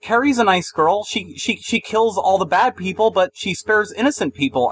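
high-pitched mock pleading director; Kimberly Pierce. Director defending Carrie to the audience, mocking imitation. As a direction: imitate someone defending her character, voice lifted. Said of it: "I felt like Kimberly Pierce is like".

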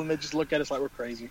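ordinary delivery

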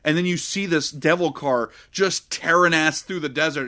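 usual speaking voice

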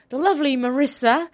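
put-on English accent. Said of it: "get rid of this English accent".

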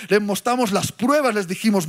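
normal talking voice

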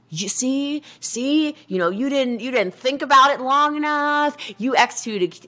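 lightly mimicked high-voiced critics; naysaying friends. Friends' mocking criticism voiced in a story.

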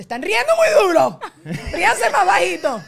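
high, mock-outraged yell; loud scolding. Complainer telling restaurant diners off, loud mock scolding. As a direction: holler at diners across the room.

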